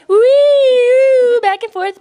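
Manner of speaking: high, loud swinging whoop; gleeful whee. Retold or imitated. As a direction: squeal like a kid on a swing. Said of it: "it's just like".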